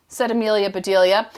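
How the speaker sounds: ordinary delivery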